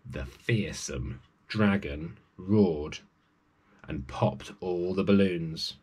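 slow, low and menacing; slow dramatic storyteller menace; ominous slow build. A narrator's reading. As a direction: draw it out to scare the listener. Menacing dragon entrance.

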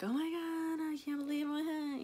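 high gushing sentimental gasp; overly emotional. Retold or imitated. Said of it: "You're more like, emotional, sentimental".

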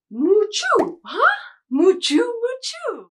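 high loud mooing sneeze; cow sneeze. Pretend. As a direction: moo and sneeze in one. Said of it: "He's going to sneeze".

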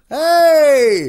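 loud cheerful hey; calling out. Real, not acted. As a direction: call out across the room. Calling out to video call.

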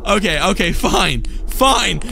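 ordinary talk

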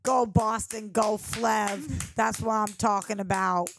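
Boston fan cheer; Boston accent. Acted. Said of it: "when you do the Boston accent".

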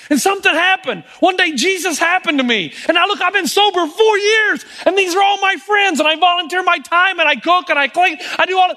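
overflowing with gratitude; joyful testimony. Retold or imitated. Saved man testifying to his pastor, ecstatic. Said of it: "What he really meant is".

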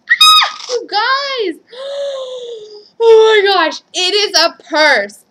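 high, loud delighted screaming, slow at the end. Real, not acted. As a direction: scream with joy over the purse. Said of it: "I'm sorry for the little girl screaming".

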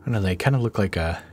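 ordinary talk

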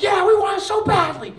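high, loud, desperate eagerness; overeager group. Retold or imitated. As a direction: beg to be fit. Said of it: "the group's like".

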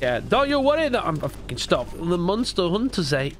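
normal talking voice

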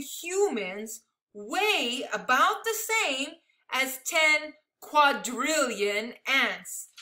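animated, emphatic picture-book reading; big numbers stretched out for effect; read-aloud to children. Acted. Reading a picture book of amazing numbers to children, animated storytelling.

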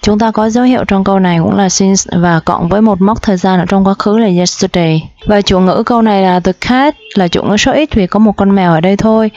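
normal talking voice

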